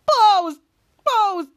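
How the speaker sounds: loud, high falling cry; frustrated outburst. Retold or imitated. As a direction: shout it out in frustration. Captain Man curses after being left.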